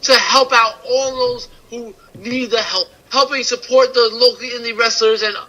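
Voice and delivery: ad read. Acted. Host reading a sponsor spot to listeners, soft ad read. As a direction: sell the shirts like a host ad.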